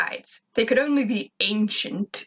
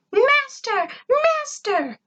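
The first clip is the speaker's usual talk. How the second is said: much higher, eager robot cries; excited cry; much slower than usual. A narrator's reading. Robot Gur runs in calling his master, excited. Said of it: "he cried excitedly".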